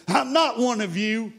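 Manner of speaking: high, strained cry of denial, not full volume; acted-out screamed protest, pitched well above the telling; retold scream. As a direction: throw it up high like a held-back scream. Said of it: "he must have screamed at them".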